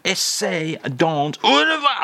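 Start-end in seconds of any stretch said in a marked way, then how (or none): 1.4-2.0 much higher, louder sing-song au revoir; hammed-up farewell. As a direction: fling out au revoir theatrically. Interrupting farewell.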